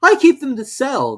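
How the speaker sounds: high, loud apologetic voice; a nervous hatter excusing himself; Hatter's voice. A narrator's reading. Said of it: "the Hatter added".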